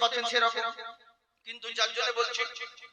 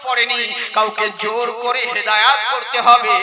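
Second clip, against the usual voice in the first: loud, fiery sermon rebuttal; preaching at full force; 10 dB louder than usual. Acted. Preacher rebuking the guardian before the crowd, fiery and loud. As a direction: thunder the answer at the excuse.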